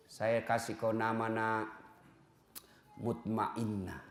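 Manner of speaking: put-on name the baby gently, like a blessing; tender naming. Parent softly naming the newborn daughter.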